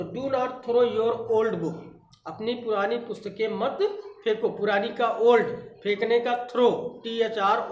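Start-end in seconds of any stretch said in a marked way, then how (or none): 0.0-1.8 very slow, word by word; slow dictation. Shown as a demonstration. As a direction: dictate it twice for the class. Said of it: "तो".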